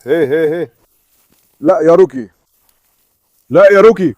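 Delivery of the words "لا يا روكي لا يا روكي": loud sharp no to the dog; scolding shout. Real, not acted. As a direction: stop the dog with a loud call. (زعيق على الكلب)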